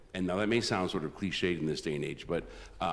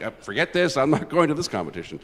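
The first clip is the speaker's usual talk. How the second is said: higher and louder, throwing up his hands; mock panic as Marchand quits before the contest; exasperated outburst; 9 semitones higher, 7 dB louder than usual. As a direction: blurt it out like a rival giving up. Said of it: "he's like".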